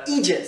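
"idiot" pronounced "eejit"; Scottish "idiot". Shown as a demonstration. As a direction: say "idiot" as EE-jit, Scottish style. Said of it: "quand je le dis". (« idiot » prononcé « idjit » à l'écossaise)

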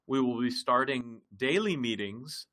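ordinary delivery

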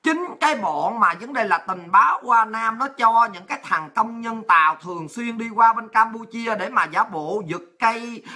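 usual speaking voice

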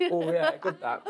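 playing his dad happily tucking in. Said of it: "My dad goes straight in".

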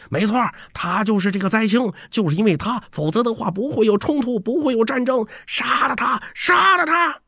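high-pitched mock crowd cries, climbing to the end; clamouring mob. A narrator's reading. Followers echoing the accusation against the jinx, high clamouring voices. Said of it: "随着嘈杂的呼喊声响起".

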